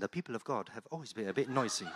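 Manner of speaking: quick, soft mock English accent; A W Tozer's English accent. Preacher quoting a writer in a mock English accent. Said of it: "he says in that English accent".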